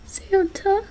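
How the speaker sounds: high, soft questioning voice; astonished. Acted. Listener turning round to see Santa, astonished. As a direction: say it in hushed, high disbelief.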